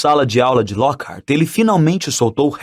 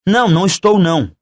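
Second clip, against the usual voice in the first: a touch of anger, slightly raised pitch; angry; 4 semitones higher, faster than usual. A narrator's reading. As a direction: deny it crossly. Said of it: "disse Harry com raiva". (com um pouco de raiva)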